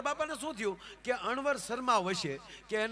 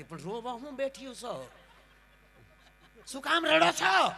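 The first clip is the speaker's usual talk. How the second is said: mocking bride; 4 semitones higher, 5 dB louder than usual. Bride mocks the weeping family.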